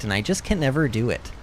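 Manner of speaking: ordinary delivery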